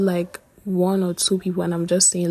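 normal talking voice

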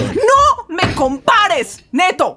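slow, high, drawn-out angry shout; furious. Acted. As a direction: stretch every word out in fury. Furious at being compared.

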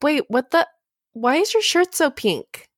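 high confused question; puzzled, rising up in pitch; surprised. Retold or imitated. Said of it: "And I'm like".

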